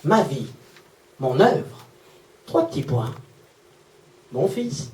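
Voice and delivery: low, hushed, pausing after each phrase; quiet, heartfelt reveal; tender. Retold or imitated. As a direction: read it softly, letting each piece land. Touching final reveal of mother's note.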